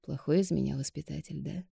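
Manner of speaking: soft, rueful question; quiet self-doubting ask. A narrator's reading. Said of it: "тихо спросил".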